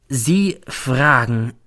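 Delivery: very slow, weighted words; slowly. Acted. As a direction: draw out each word, stressing both. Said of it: "sagt dann langsam". (langsam, jedes Wort betont)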